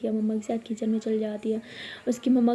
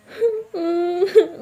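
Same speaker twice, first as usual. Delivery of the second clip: hammed-up fake sob; 6 semitones higher, 4 dB louder than usual. Abira's fake crying.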